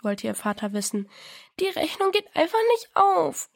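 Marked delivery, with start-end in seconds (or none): 1.6-3.5 high, lightly sobbed despair; a touch of tearful pleading, pitched up; sobbing. A narrator's reading. Diana to her father over her sums, mildly sobbing. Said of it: "schluchzte Diana".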